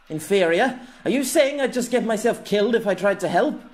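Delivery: high, loud outraged retort; indignant protest. A narrator's reading. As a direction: fling the question back, pitch shooting up. Jem protests the golem's verdict.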